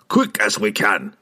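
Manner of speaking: slow, pressing dwarf voice; urgent dwarf. A narrator's reading. Dwarf urging party onward, urgent. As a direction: push them on.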